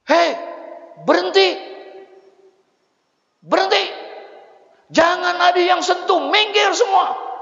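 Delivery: high, loud shout to stop everyone. Retold or imitated. Husband shouting at mourners to keep off the coffin. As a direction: yell at the crowd to stand back. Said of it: "teriak ini laki".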